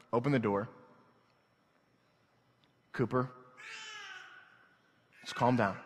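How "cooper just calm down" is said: restrained, spaced-out fatherly calming. Retold or imitated. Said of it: "in this frame of mind".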